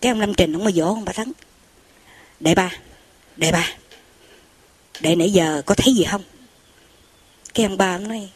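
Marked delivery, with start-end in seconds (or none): 2.4-6.2 urgent low wake-up call. Retold or imitated. Monk urgently wakes his companion.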